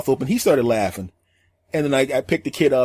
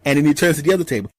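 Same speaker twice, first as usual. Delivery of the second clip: ordinary delivery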